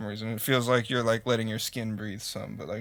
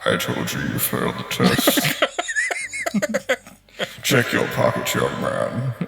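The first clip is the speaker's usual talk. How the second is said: low leprechaun voice; 13 semitones lower, 4 dB louder, slower than usual. Acted.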